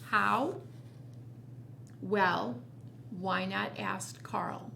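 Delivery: ordinary delivery, nothing marked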